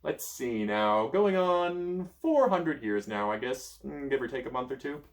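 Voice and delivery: slow, drawn-out reckoning; musing wizard. Acted. Merlin working out his years, slow. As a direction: count the centuries.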